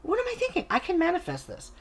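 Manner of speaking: voicing a client's inner aha, pitched high; sudden realization.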